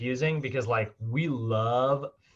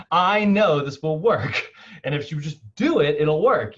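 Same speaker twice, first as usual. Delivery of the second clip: louder, mildly exasperated insisting; frustrated trainer; 5 semitones higher, 7 dB louder than usual. Retold or imitated. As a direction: imitate a trainer who is fed up that his plan is ignored, a bit louder. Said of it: "he was almost frustrated".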